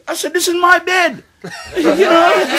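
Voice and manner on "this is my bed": indignant yell. Retold or imitated. Confronting the ghost in bed.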